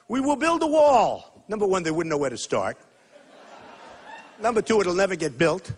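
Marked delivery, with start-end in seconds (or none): 0.1-1.4 high, loud, slow mock proclamation; mocking politicians. Candidate mimicking rival politicians at a rally, mock-bold. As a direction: puff it up as a booming copycat pledge. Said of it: "these politicians are coming out".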